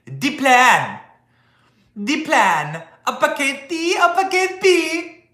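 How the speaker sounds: high, mock-slow French-accented parody of Harris. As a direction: ham up her line in a high French-tinged voice. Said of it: "but with a French accent".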